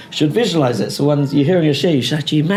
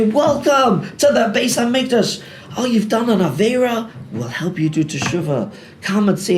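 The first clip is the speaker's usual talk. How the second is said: an inspired Cohen welcoming a sinner, pitched high; enthusiastic Cohen; 7 semitones higher than usual. Pretend. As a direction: sound like the Temple is alive. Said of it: "the Cohen say".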